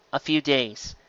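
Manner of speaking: usual speaking voice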